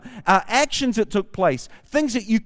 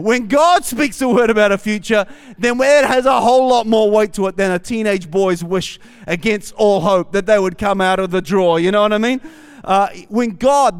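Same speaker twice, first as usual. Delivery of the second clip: loud, building preacher's cadence; preaching; 3 semitones higher, 6 dB louder than usual. Acted. Pastor preaching to the church, loud and building. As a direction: preach it with rising force over the congregation.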